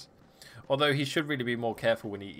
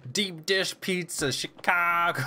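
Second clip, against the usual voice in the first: higher, slow attempt at a Chicago accent, Chicago drawn out; 6 semitones higher, slower than usual. Shown as a demonstration. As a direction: try a Chicago accent and miss. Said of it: "I can't do the accent".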